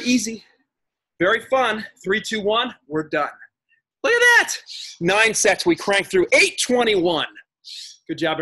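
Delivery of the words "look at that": high, loud burst of delight; loud, high look at that; excited exclaim. Real, not acted. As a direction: cheer them on, thrilled. Instructor excited at progress.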